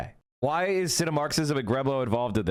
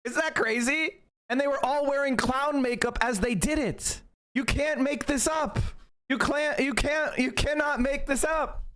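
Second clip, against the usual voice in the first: incredulous disbelief; 10 semitones higher than usual. Real, not acted. Incredulous rant about clowns.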